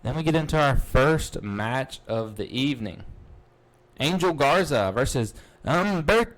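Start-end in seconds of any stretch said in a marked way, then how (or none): 4.0-6.4 higher and louder, the last name soaring; ring announcer call. Acted. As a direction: belt out the matchup like an arena announcer.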